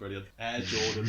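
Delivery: low, deadpan acted reply; mock unimpressed. The star answering cartoon fans, mock unimpressed. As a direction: drop the pitch, thoroughly underwhelmed.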